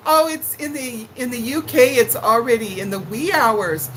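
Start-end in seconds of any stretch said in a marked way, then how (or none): none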